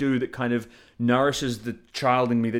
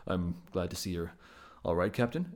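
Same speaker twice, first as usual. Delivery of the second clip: Spock mode; 5 semitones lower, 6 dB quieter than usual. Retold or imitated. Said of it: "goes back into Spock mode".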